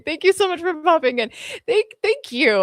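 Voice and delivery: high, fast, emotional thank-you; choked-up thanks. Real, not acted. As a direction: near tears with gratitude. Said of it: "I'm gonna cry".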